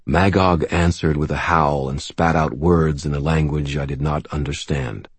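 usual speaking voice

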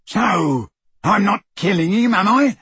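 building loud, high yell. A narrator's reading. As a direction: yell it back defiantly. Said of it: "yelled Crabbe".

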